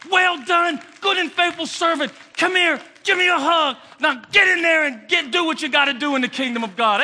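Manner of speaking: loud, high Jesus welcoming home; joyful shouting. Retold or imitated. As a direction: shout it with open arms. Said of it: "Jesus praising arriving believers".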